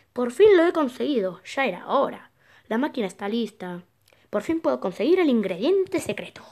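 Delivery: loud, dramatic reading with swooping emphasis; triumphant villain's diary. Acted. Gamer reads villain's found diary aloud, triumphant. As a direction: gloat over a long-awaited breakthrough.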